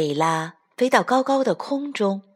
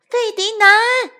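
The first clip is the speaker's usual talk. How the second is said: voice from the sky; 4 dB louder than usual. Acted. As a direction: call down from the sky. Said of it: "天上传来一个声音".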